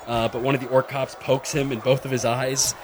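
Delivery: usual speaking voice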